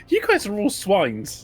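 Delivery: laughing. Acted. Joking insult amid laughter.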